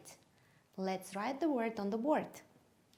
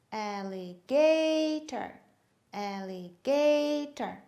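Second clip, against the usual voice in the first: alligator slowly, GA long and raised; stress on GA; 3 semitones higher, 4 dB louder, slower than usual. Shown as a demonstration. Teacher helping children find the stress, stress on GA. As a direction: draw out GA in alligator each time. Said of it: "Let's find the stress".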